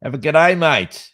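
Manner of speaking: loud, broad Aussie greeting; exaggerated Aussie. Reading a listener's message, broad Aussie accent. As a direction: ham up the Aussie g'day.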